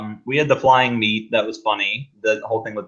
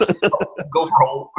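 dog voice; 6 semitones higher than usual. Retold or imitated. Quoting the movie line.

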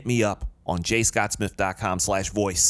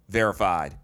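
ordinary talk

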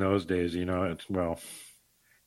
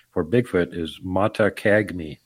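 ordinary talk

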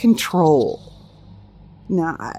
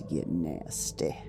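whisper; 11 semitones lower, 9 dB quieter, slower than usual. Acted. Whispered warning.

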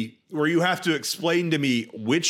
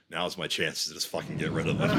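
low, hushed plotting voice; secretive mutter to oneself; scheming aside; 11 semitones lower, 6 dB quieter than usual. Acted. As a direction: think it aloud, low and quiet. Voicing a character's secret scheming thought.

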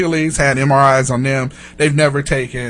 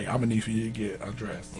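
softer mock nurse's request; 7 semitones lower, 18 dB quieter than usual. Nurse asking the patient to undress, said low and hushed. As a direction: hush it like an awkward nurse's order. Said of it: "look like this lady look and say".

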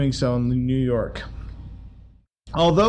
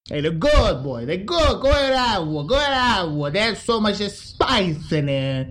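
higher, jokey wiseguy brag; mob sausage seller; 4 semitones higher than usual. Acted. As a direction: proudly push the sausages like a mobster. Frankie answers about sausages.

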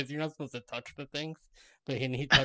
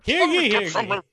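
town crier; 12 semitones higher, 8 dB louder, slower than usual. Pretend.

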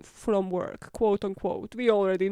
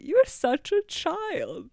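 mocking taunt; 7 semitones higher, slower than usual. Wife mocks husband, laughs at him.

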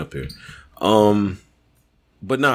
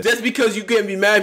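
usual speaking voice

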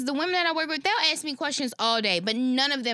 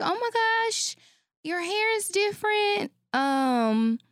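high, gushing coworker gasping over hair; mock-excited coworker, pitched up; high-pitched imitation of a nosy coworker; imitating coworker; 8 semitones higher, slower than usual.

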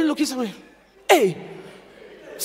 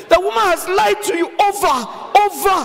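exasperated shout; 6 semitones higher than usual. Real, not acted. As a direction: shout it in disbelief at him. Preacher exclaims over Samson's blindness.

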